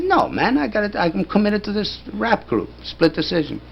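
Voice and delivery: high-pitched defensive retort; protesting. Retold or imitated. Narrator telling friends he's committed to rap group, protesting. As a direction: plead your case to doubting friends.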